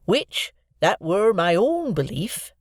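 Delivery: fumbling for grand words, sincerely; Joe's voice. A narrator's reading. Joe thanking Miss Havisham, earnest and fumbling.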